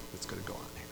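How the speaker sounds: hushed, ominous whisper. Acted. Preacher warning of tribulation in a whisper. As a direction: drop it to a whisper.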